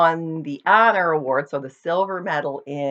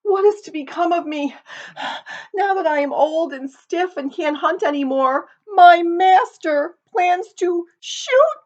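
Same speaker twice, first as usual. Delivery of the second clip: put-on high, strained old dog voice, out of breath; a worried old dog pleading, gasping; 8 dB louder than usual. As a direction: gasp it out like a worn-out hunting dog. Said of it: "gasped the dog".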